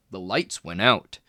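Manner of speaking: ordinary delivery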